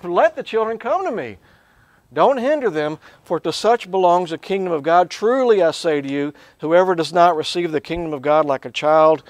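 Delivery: high, forceful voice of indignation; indignant rebuke, starting high and easing down in pitch. Retold or imitated. Jesus rebuking the disciples who turned children away, indignant. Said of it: "He was indignant".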